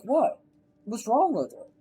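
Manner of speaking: a light imitation of Tom brushing off the dad; mock innocent Tom. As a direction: ask it like you see no problem.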